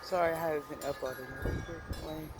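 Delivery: ordinary delivery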